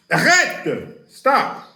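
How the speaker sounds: high, loud cry to stop; alarmed shout. Retold or imitated. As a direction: yell at him to stop. Other side answers the threat.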